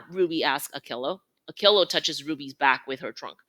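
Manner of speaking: ordinary delivery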